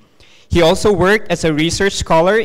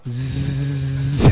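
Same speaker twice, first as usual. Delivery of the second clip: bee buzz; 2 semitones lower, 4 dB quieter than usual. Pretend. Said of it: "can we do a bee sound".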